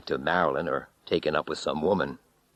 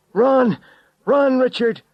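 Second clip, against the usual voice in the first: desperate scream, much higher and louder; 9 dB louder than usual. A narrator's reading. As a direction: scream the warning. Screaming warning at friend.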